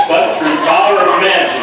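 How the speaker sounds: ordinary delivery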